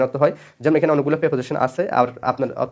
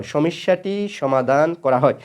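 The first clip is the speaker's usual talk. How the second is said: drawn out at half speed; slow repetition; much slower than usual. Shown as a demonstration. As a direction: say the example slowly, word by word, for students. Example repeated slowly for students.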